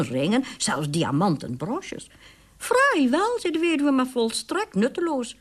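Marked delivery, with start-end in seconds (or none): 2.6-5.4 dismissive squeak. Acted.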